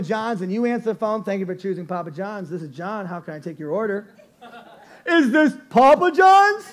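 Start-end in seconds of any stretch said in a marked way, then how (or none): 1.2-4.1 polite phone greeting. Retold or imitated. Answering phone as employee. 5.1-6.6 high, loud, slow prank-caller voice. Retold or imitated. Prank caller asking the pizza clerk, high and mocking.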